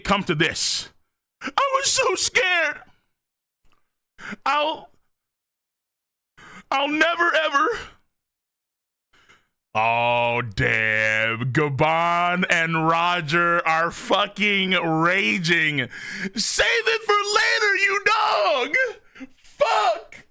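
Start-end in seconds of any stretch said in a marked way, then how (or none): 1.4-7.7 hammed-up sobbing; mock crying. Voicing crying character. 16.3-20.3 much higher shouted taunt; hammed-up furious shout; mock rage. Voicing raging characters.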